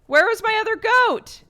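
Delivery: high, loud, panicked cry for the goat; panicked shout. Real, not acted. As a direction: yell in real alarm.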